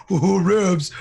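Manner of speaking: put-on loud high cooing baby voice; mock baby talk. A stranger cooing baby talk at Rebs, loud and high. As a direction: coo sweetly like to an infant. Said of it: "came up to me and was like".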